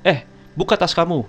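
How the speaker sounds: put-on a sharp-tongued senior girl, curt and bossy; curt retold order. Older schoolgirl bossing the new kid around, curt and snappy. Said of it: "Dengan nada ketus ya".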